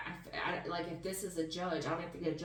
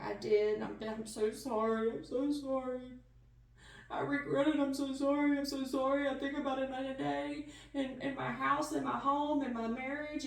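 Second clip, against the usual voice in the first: much higher and louder, an acted tearful begging; pleading apology; 6 semitones higher, 4 dB louder than usual. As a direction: plead as if sorry and close to tears. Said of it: "this is somebody who's like".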